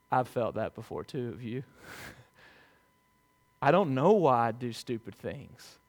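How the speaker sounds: ordinary talk, nothing marked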